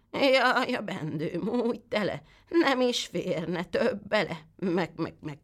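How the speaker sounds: a sly goat bleating its rhyme, pitched high. Acted. The goat fibs to the boy in a bleating goat voice. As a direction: stretch the rhyme slowly and end on a bleat.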